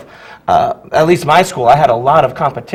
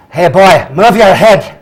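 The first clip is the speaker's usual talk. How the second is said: put-on loud, raised-pitch bark in Shrek's accent; Mike Myers yelling dad accent; 5 semitones higher, 6 dB louder, slower than usual. Drama teacher demonstrates his audition accent, dad yelling at a kid. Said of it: "as his dad yelling at a kid".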